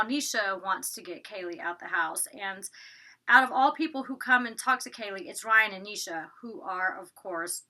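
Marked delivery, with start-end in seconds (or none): none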